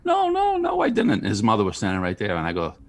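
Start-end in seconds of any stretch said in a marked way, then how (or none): 0.1-1.1 put-on much higher whiny kid voice, insisting; kid denying. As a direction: play the little liar protesting. Kid denying, quoted by uncle.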